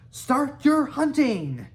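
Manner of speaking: high, loud, slow shouted start; a Viking chief shouting to start the hunt; yelled command. A narrator's reading. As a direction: bellow it to the whole crowd. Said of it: "yelled Gobber the Belch".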